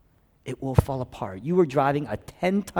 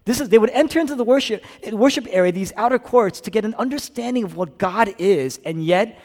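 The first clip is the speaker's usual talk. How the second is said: loud, high-pitched preacher's urgency; impassioned preaching; 7 semitones higher, 9 dB louder than usual. Real, not acted. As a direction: preach it at full force, voice raised. Preaches at full force.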